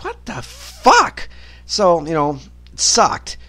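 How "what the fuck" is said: disbelief. Retold or imitated. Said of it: "I'm like".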